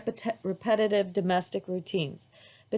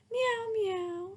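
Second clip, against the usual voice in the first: high, soft mewing kitten; child playing a kitten; kitty meow; 14 semitones higher, 5 dB quieter than usual. A narrator's reading. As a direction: mew like a small cat. Girl pretending to be kitty.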